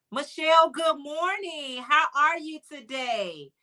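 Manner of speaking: cheery greeting. Real, not acted. Greets a viewer by name.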